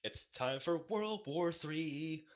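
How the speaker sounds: slow, ominous mock proclamation; mock announcer. Hammed-up doom announcement to friends, very slow. Joking about a feud.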